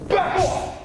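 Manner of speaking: loud, high commanding shout; strong voice. Shown as a demonstration. Teacher models warning Doc Ock, loud commanding shout. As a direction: stop a villain cold with your voice. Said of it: "drill phrase".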